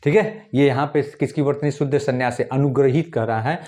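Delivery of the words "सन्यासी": ordinary talk, nothing marked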